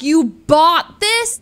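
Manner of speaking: loud, slow, high disbelief; shocked disbelief. Retold or imitated. To her boyfriend about the gift, loud slow disbelief. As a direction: drag it out in shock. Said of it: "I'm like".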